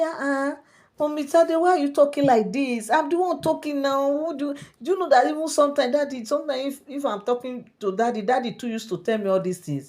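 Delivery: put-on high child voice, insisting; acted high-pitched girlish protest; protesting little girl. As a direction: voice a child pleading her case, pitched well up. Child protests to the questioning queen.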